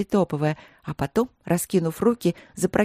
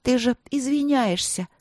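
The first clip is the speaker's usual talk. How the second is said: higher, needling little-princess voice; bratty; 7 semitones higher than usual. A narrator's reading. Karolina needles Wei who is apologising, bratty. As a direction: say it in a bratty sing-out. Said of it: "заметила Каролина вредным голосом".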